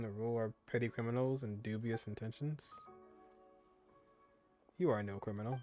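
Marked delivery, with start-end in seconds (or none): none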